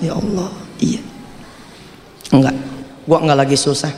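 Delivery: a hint of crying, hushed; tearful. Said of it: "nyeduh teh manis sambil nangis".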